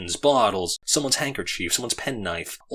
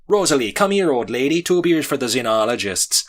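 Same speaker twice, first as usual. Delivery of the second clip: higher, louder call across the bar; 6 semitones higher, 7 dB louder than usual. A narrator's reading. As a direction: hail the waitress over the noise. Calling the waitress across bar.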